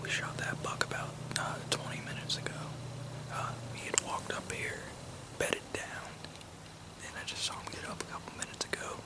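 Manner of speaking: hushed whisper, very soft and unvoiced; quiet whispered field update from a hunter; real whisper. Real, not acted. Whispers in the field after shot.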